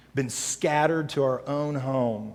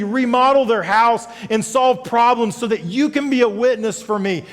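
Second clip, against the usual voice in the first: louder, higher preaching to the congregation; full-force preaching; 11 semitones higher, 11 dB louder than usual. Acted. Pastor rallying congregation to serve, preaching hard. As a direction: pastor urging the church to go serve.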